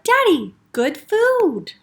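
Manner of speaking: put-on high-pitched little kid voice; pleading child. A narrator's reading. Taya defending her candy to her father, pleading. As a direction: coax Daddy like an eager child.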